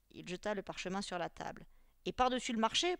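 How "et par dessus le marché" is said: higher, louder angry addition; furious. A narrator's reading. Teacher carries on berating his pupils, furiously. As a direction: pile on the criticism angrily. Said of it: "poursuivit-il furieusement".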